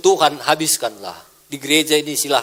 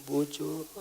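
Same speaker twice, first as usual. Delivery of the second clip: feeble, not shouted; 15 dB quieter than usual. Shown as a demonstration. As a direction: say BUJUR softly, with no force at all. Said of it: "nggak dia bilang".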